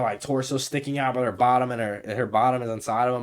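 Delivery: ordinary talk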